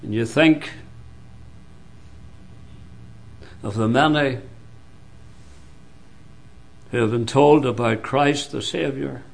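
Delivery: low, hushed sermon with long pauses; solemn preaching. Real, not acted. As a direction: drop low and soft, warn the congregation gravely. Preacher drops to solemn warning.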